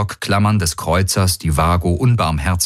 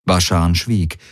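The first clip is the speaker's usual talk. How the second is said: ordinary delivery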